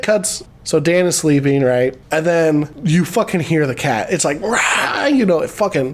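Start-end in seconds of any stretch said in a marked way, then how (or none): 4.4-5.1 high imitated yowl of a frantic cat; cat screech. Imitating the cat heard at night, freaked-out screech. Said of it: "the cat is freaking out".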